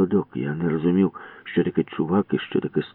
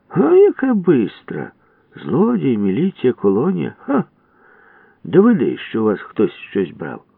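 mocking scorn; 6 semitones higher, 5 dB louder than usual. Budka mocking.

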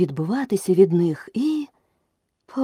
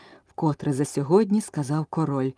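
plain reading voice

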